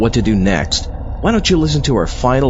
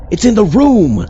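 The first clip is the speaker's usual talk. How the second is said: higher, louder, rising alarm; panicked; 11 semitones higher, 4 dB louder than usual. Acted. Assistant warning Doc about the ghost in the room, panicked. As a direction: sound the alarm, it's here.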